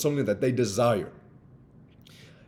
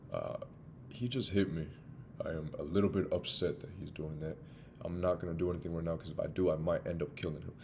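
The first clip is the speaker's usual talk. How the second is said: soft, quiet, reasoning with yourself; calm inner voice; 7 semitones lower, 13 dB quieter than usual. Retold or imitated. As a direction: talk yourself down under your breath. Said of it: "you talk to yourself and you say".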